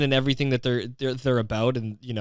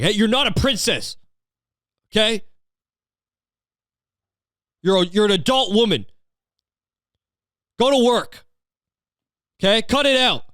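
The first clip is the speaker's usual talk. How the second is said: high, loud mock scolding; losing his mind; 10 semitones higher, 4 dB louder than usual. Husband telling his wife to grow up, mock-furious scolding. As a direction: tear into her in exasperated bursts. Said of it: "fucking lost his mind".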